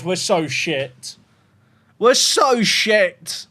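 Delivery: drawn-out despair, very slow; slow, defeated groan of words; slow lament. Real, not acted. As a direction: stretch every word in misery. Lamenting result.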